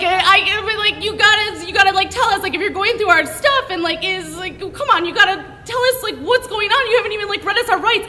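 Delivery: pleading rattled and unsure; nervous pleading. Retold or imitated. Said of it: "And I'm like".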